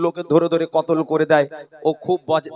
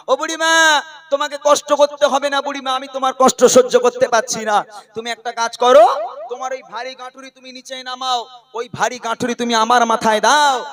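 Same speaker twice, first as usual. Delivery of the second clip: higher-pitched, quick acted pleading; earnest plea; 4 semitones higher, much faster than usual. Kind man begging an old woman to hand over her load, earnest plea. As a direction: call out to her, then urge her in a rush.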